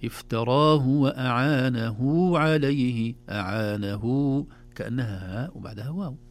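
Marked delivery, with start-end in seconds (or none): 0.0-3.1 افتراه with short hu, وأعانه stretched to huu before عليه; right reading. Shown as a demonstration. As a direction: slowly recite the right version. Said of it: "لا،".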